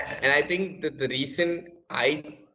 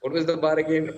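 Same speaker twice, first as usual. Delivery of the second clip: ordinary talk, nothing marked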